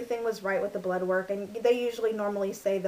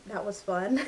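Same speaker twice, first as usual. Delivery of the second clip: normal talking voice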